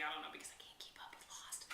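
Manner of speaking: embarrassed whispered confession; whispered aside. Real, not acted.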